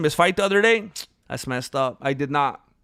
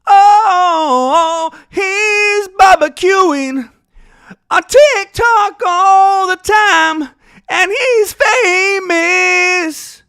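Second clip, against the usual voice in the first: much higher, louder, slow shout-singing; high slow shouted song; mock belting; 12 semitones higher, 9 dB louder, much slower than usual. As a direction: belt it like an anthem. Shouting sung tribute.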